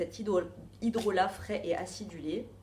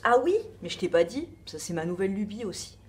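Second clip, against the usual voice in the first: ordinary talk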